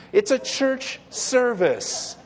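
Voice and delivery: "service" slowed and set apart; slow, deliberate "church service" stressing "service". Shown as a demonstration. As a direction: draw out "church", then land on "service". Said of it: "with the emphasis on the word service".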